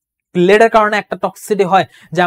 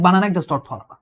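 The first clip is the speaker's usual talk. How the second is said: ordinary talk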